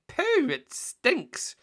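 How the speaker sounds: very high, slow disgusted whine. Acted. Len digging the muck heap, high slow disgust. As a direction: hold your nose and say it.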